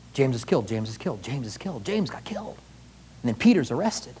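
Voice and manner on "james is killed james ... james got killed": growing softer each time, like a rumour dying away; fading hushed echo. Retold or imitated. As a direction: let each repeat fade. News of death spreading, fading.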